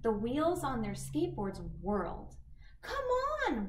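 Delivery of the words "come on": excited whoop. Acted. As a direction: whoop with glee. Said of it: "whooped Poppy".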